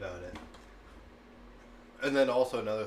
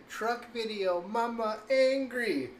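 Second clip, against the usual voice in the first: put-on much higher, louder and slow, a chanting little kid; sing-song it like a two-year-old; toddler voice; 12 semitones higher, 7 dB louder, slower than usual. Mom imitating her son repeating a warning, slow high toddler voice. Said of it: "And, like".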